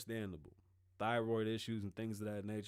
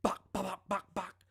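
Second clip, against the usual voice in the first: imitated gunfire; 7 semitones higher, 4 dB louder than usual. Retold shootout.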